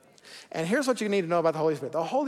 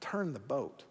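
much softer, lower and tender; gentle and kind; 5 semitones lower, 11 dB quieter than usual. Retold or imitated. God gently telling a straying believer to turn back, soft and kind. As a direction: coax them home gently, almost hushed. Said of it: "gently and kindly says".

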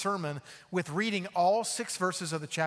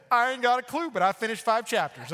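mock careless reader; 6 semitones higher, 6 dB louder than usual. Mock answer of hurried reader.

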